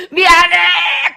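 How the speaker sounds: long high loud wail; mock wailing apology. Husband barging in to repent to his wife, wailing. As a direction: bawl out the apology, drawn out. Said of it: "막 울어 제키는 거예요".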